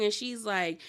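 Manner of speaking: usual speaking voice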